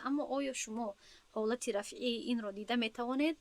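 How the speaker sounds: normal talking voice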